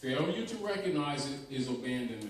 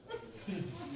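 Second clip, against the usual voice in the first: soft breathy laugh; 8 semitones higher, 10 dB quieter than usual. Real, not acted. Teller laughs at own joke.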